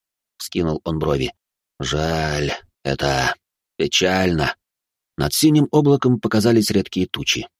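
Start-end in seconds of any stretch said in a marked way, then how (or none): 1.8-4.6 lower, lightly voiced old man; a touch of a sorry old man. Old man speaks sadly.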